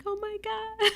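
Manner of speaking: very high squeal of disbelief; overwhelmed. Retold or imitated. Recalling the full audience at her show, high thrilled squeal. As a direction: gasp it out, thrilled and stunned. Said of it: "And I was like".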